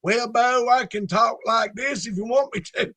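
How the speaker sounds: put-on down-home Southern voice; Southern accent. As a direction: lay on a Southern accent for the punchline. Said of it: "where's your Southern accent?".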